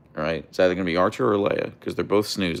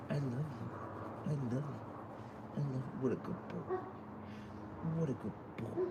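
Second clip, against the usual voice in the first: very soft, tender cooing, pitched up; soft pet talk; 5 semitones higher, 16 dB quieter than usual. Real, not acted. Streamer telling her dog I love you, tender hushed coo. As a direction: murmur love to a dog, barely audible.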